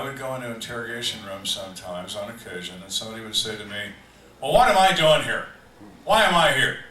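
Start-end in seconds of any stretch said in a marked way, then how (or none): 4.4-6.8 loud, higher demand of a riled suspect; a loud, higher, indignant suspect asking why he's been brought in; indignant protest. Retold or imitated. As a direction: bark the questions like an angry suspect.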